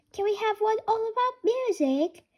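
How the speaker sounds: put-on child asking for a music story; little pig voice. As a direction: ask sweetly like a little girl.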